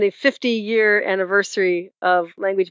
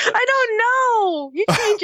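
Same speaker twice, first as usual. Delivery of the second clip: high, very slow mock cry; mock whine; much slower than usual. Teased host mock-crying to co-host, drawn-out fake whimper. Whining at co-host's teasing.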